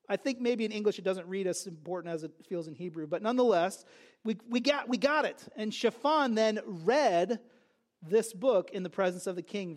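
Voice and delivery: ordinary talk, nothing marked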